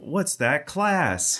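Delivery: slow high cartoon quiz call; hammy game-show question; Pokemon narrator voice. Continues the Pokemon quiz joke.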